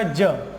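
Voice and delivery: 'ज' as a lone low, falling sound; phonics-style 'ज'; letter-sound demo. Shown as a demonstration. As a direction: give 'ज' as the answer, dropped in pitch. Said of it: "उच्चारण क्या रह जाएगा".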